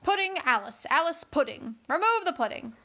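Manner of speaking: high, loud, sulky queen; sulky growl. Acted. Sulky queen introduces Alice and pudding, loud and cross. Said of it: "and growled".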